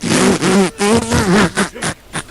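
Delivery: high-pitched burst of laughter; reenacted uncontrollable laugh; imitated laughing fit. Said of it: "I just went".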